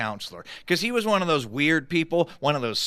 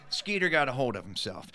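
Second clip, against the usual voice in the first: ordinary delivery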